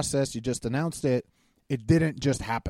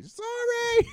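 very high, called-out apology; Wendy. Retold or imitated. Co-host imitating a voice heard during podcast, high shouted apology. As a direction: imitate someone calling from the background in a high shout. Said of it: "you hear Wendy".

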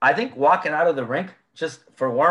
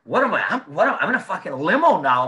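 laughing disbelief; 4 semitones higher, 4 dB louder than usual. Retold or imitated. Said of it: "I was like".

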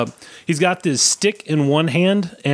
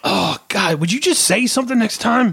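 much higher put-on dwarf voice; startled, pitched up like a jumpy oddball; Pagli's odd voice; 7 semitones higher than usual. The dwarf startled by Droth's shout, high put-on voice. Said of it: "is that how he talks?".

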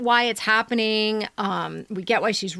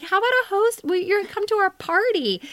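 much higher, sing-song neighborly invite; mock sweet invitation; 11 semitones higher than usual. Host imitates the letter's narrator inviting the neighbor, high mock sweetness. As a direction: play the narrator's chirpy offer. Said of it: "because she ends with, like".

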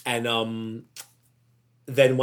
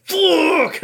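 sudden high, loud curse; explosive angry expletive; angry curse shout; 8 dB louder than usual. Retold or imitated. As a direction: blurt it out like something just went wrong. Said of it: "my automatic thing is just like".

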